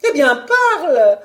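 high, loud, imperious wife; impatient baroness; impatient command. Acted. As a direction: order him to speak, high and forceful. Impatient baroness orders husband to speak.

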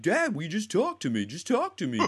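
put-on high, pleading teenage son. Retelling Walt Junior begging his dad to talk.

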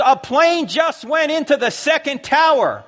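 high, loud, drawn-out alarm; retold screaming. Pastor shouting news of the crash down the phone, high alarmed yell. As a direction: shout the news slowly in acted shock. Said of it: "he screams into the phone".

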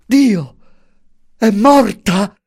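high, loud cry of horror; anguished high-pitched yell; horrified scream. A narrator's reading. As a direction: scream it out in despair. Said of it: "Urlò".

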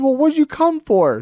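"what'd" flung up high and loud; high teasing exclamation. Retold or imitated. He teases a nervous guest on the TV set, high and loud.

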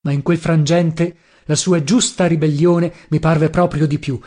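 plain reading voice

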